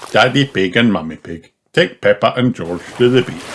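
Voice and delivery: ordinary delivery, nothing marked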